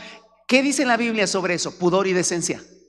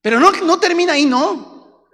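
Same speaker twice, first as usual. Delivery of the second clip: fiery preaching; 11 semitones higher, 7 dB louder than usual. Acted. Preaching at full force.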